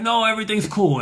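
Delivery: very high, loud imitation of a Jamaican; Jamaican man. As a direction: back off hastily in a high Jamaican voice. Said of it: "Jamaican guy".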